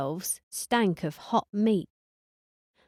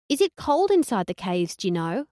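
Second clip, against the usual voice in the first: Hillary twang. Acted. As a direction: play the ditzy Hillary, bright and put-on. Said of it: "in my Hillary twang".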